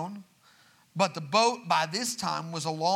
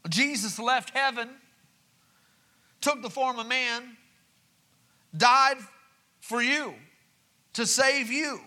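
higher, louder, driving preacher cadence; preaching build; 5 semitones higher, 4 dB louder than usual. Acted. Pastor assuring the church God is not distant, preaching hard. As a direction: lift it up and preach it home.

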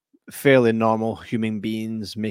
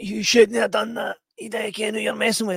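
much higher, strained threat; shot man's threat; 11 semitones higher than usual. Retold or imitated. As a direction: snarl it while in pain. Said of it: "It's like".

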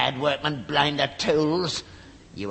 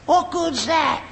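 much higher, disgusted groan of a question; exasperated complaint; 12 semitones higher, much slower than usual. Acted. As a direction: go up high in disgusted disbelief. Father inspects the damaged chaise.

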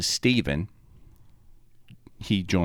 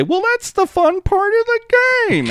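much higher, smug teasing voice; mocking Lewis; 3 dB louder than usual. Friend mocking Lewis's smug line during codenames, high teasing voice. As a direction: mimic him needling you on your turn. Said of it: "he'll sit there and say".

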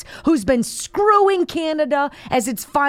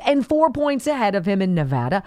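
usual speaking voice

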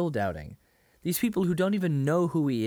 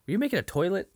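higher, pointed mock question; mock incredulous; 8 semitones higher, much faster than usual. Friend asking the carpenter, higher mock disbelief. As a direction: toss the question up high in disbelief. Acted quote in retold scene.

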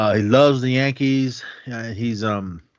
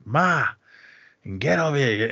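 put-on Italian-American accent; 5 semitones higher than usual. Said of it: "that Italian accent, the way they talk".

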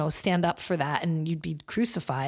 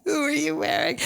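laughing; 8 semitones higher than usual. Real, not acted. Laughing repeat of the red carpet question.

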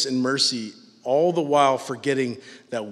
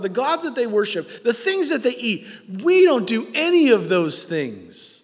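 scornful self-righteous people; 8 semitones higher than usual. Retold or imitated. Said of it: "they said to him".

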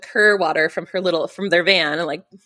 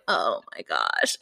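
dismayed; 12 semitones lower, 5 dB quieter, slower than usual. Retold or imitated. Hearing radiator is bone dry.

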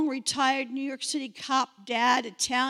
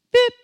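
short, high, loud beep; sudden imitated tracker beep; beacon beep; 11 semitones higher, 8 dB louder than usual. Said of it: "you hear beep".

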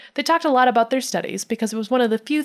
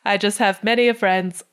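ordinary delivery

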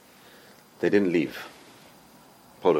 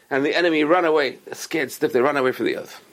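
normal talking voice

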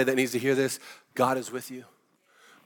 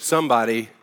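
usual speaking voice

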